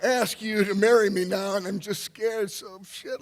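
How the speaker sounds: high, loud, laughing through tears; tearful laughing confession. Retold or imitated. Confessing to Sue in the cave, high tearful laughter. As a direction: confess it while half laughing, half crying.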